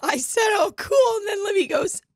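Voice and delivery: high, squeaky laughing speech; cracking up, words squeezed high; real helpless laughter. Real, not acted. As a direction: barely get it out through laughing. Cracking up while explaining the joke.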